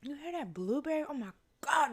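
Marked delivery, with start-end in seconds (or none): none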